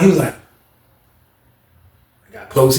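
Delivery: normal talking voice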